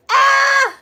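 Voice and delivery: high, loud squeal of joy; excited scream. Retold or imitated. Reliving his girlfriend proposal, a high excited scream. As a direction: shriek like the proposal just landed.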